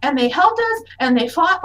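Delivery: high, gushing youngster's voice; excited kid. Acted. Child vouching for the strangers to Sinead, excited kid. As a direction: gush it eagerly like a child.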